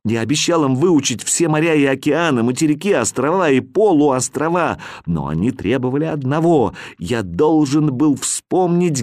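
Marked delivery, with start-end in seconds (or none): none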